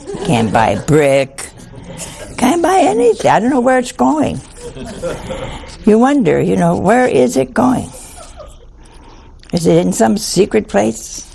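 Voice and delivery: ordinary talk, nothing marked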